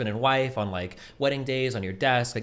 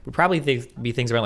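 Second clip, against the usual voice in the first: loud, higher-pitched indignant retort; angry outburst; 4 semitones higher, 7 dB louder than usual. Real, not acted. Streamer snapping back at a co-host's dig, angrily indignant. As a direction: fire back, raised voice and pitch.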